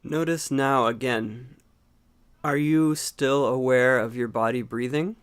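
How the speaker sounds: calm meditation guide, long unhurried pauses; guided meditation. Acted. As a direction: gently guide them into watching the breath, leaving space between phrases. Leads a breathing meditation.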